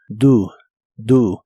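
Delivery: "Do" said as "du", the O sounding like a Spanish U; say do like doo. Shown as a demonstration. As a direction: repeat "Do" twice, rhyming with "tú". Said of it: "lo pronuncias como si la O fuese una U". ("Do" pronunciado "du", con la O como U)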